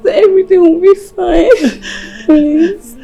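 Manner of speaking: loud, high tearful pleading; urgent consoling. Acted. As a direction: plead with her through rising emotion. Consoles a sobbing sister.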